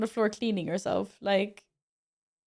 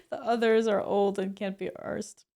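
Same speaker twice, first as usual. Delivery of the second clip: usual speaking voice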